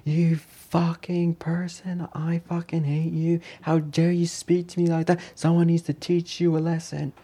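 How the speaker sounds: high, drawn-out strained yell at normal volume; mock screaming. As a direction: imitate a furious scream without the volume. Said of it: "screaming at the top of his voice".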